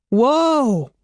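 high, loud startled whoa; surprised cry. A narrator's reading. Andy as the ball flies overhead, startled cry. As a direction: yelp as the ball sails past. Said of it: "Andy cried".

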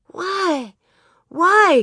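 put-on high little-girl demand; demanding child. Little Grace woken by the bang, demanding. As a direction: pipe it up high, insisting on an answer. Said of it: "Grace demanded".

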